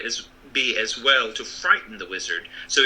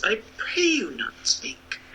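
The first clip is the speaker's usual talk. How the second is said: higher, slow pleading; pleading wizard; 10 semitones higher, slower than usual. Acted. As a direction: beg them gently.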